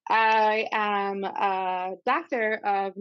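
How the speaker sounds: Doctor stretched, very slow and proud; doctor with accent. Shown as a demonstration. Guest stresses her doctor title, drawn out. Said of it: "you gotta say the doctor with an accent".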